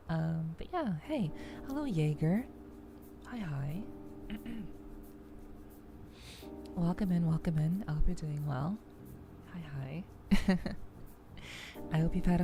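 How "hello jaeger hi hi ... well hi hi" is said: normal talking voice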